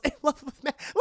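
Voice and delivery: high, scoffing repeat; acted high-pitched derision; mock incredulous echo. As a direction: throw the question back high, on the edge of laughter. Selina voiced, scoffing echo.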